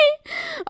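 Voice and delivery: high-pitched giggle after an outburst; squeaky trailing laugh. Real, not acted.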